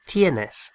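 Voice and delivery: "Tienes" as TYEH-nes, falling; "Tienes" modelled by a Spanish teacher; Tienes said clearly. Shown as a demonstration. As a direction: model "Tienes" slowly for learners. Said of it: "we say".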